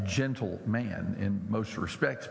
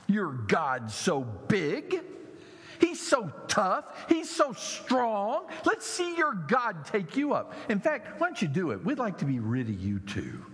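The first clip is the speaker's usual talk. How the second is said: sneering taunt; 7 semitones higher than usual. Retold or imitated. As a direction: drag out the mockery. Mockers taunting about God.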